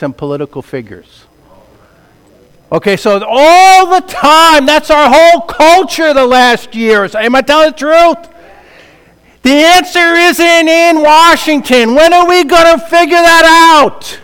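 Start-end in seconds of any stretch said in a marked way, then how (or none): 2.7-14.2 emotional outburst. Real, not acted. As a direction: preach it with raw passion. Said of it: "Sorry, I get emotional".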